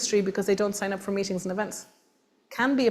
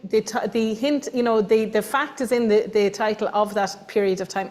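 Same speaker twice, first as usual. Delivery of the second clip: usual speaking voice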